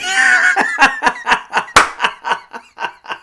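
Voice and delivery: very high, loud cackling fit; helpless laughter. Real, not acted. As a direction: explode into real uncontrollable laughter. Cracks up at Farley line.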